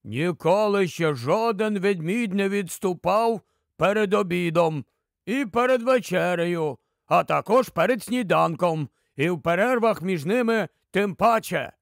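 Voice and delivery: ordinary delivery, nothing marked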